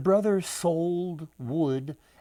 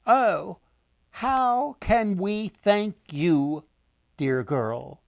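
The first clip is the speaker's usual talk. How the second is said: put-on grateful old woman; 4 semitones higher, 6 dB louder, slower than usual. Women thank the girl.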